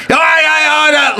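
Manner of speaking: hammed-up menace; mock threat. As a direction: threaten like a thirties tough guy. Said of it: "literally, like, threatened".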